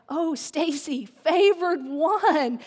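loud, drawn-out proclamation; grand angelic greeting. Acted. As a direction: hail her like an announcing angel. Acted angel greeting the speaker.

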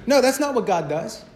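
ordinary delivery

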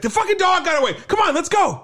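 very high-pitched mock urgency; rally them in a panic, pitch way up; imitating someone yelling after a runaway, no real volume; retold frantic outburst. Retold Avi frantic over escaped dog.

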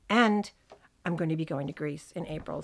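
usual speaking voice

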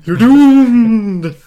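very high, loud wailing doomed; shrieked doom warning. Retold or imitated. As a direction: wail it like a mad prophet. Louder Crazy Ralph echo.

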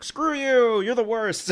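hammed-up sudden tantrum; mock angry outburst. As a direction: lash out in pretend fury. Acted sudden outburst from film.

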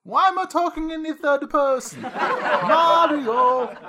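hammed-up cartoon Luigi, drawn-out cries; hammy mock-Italian character voice; Luigi in a comic skit. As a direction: play a lamenting video game plumber, overdone.

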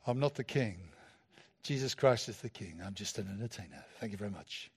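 loose, low celebrity impersonation. Acted. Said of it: "[name], sort of".

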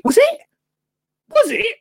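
high squeaky disbelief; surprised. Real, not acted. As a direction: gasp it out in shock. Surprised at being on telly.

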